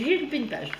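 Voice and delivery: usual speaking voice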